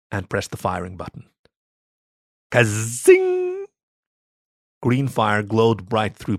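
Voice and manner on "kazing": high loud zapping sound effect; ray-gun zap. Acted.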